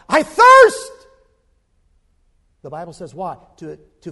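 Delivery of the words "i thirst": a high, full-throated scream of thirst; anguished cry. Acted. Preacher voicing Jesus on the cross, anguished scream. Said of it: "he cries out".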